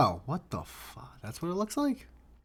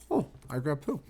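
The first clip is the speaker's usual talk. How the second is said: ordinary talk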